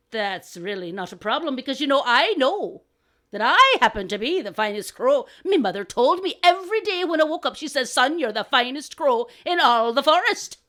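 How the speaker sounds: cocky crow, swelling as it brags; boastful crow. Acted. Second crow claiming to be finest, boastful. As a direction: build from assured to proudly emphatic.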